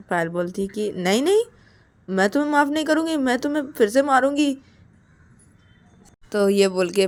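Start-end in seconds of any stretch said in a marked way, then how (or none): 0.9-4.6 high, insistent acted threat; stern refusal. Payal refuses to forgive Ananya, stern refusal. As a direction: refuse firmly with pitch lifted.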